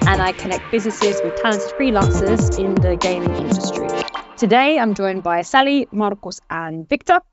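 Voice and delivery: usual speaking voice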